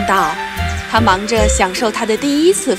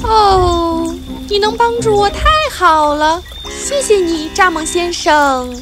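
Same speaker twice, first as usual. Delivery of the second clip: bee voice; 6 semitones higher than usual. Acted. Maya talks to grasshopper.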